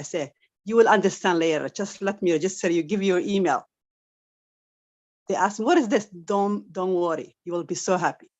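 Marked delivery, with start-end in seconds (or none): none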